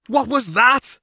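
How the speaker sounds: loud, high-pitched startled yell; alarmed shout. A narrator's reading. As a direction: shout it in alarm after a blast. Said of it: "shouted Lister".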